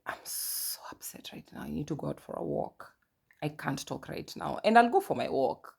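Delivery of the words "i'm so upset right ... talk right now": hushed, tightly held-back upset; restrained upset. Retold or imitated. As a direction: act out holding an outburst in, very softly. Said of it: "Because I was the explosive one".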